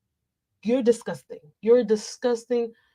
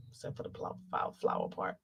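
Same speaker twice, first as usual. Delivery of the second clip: unvoiced, much softer muttered correction; whispered aside; 17 dB quieter than usual. Real, not acted. Correcting herself about the dates' flowers, whispered. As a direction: whisper the flower part to yourself.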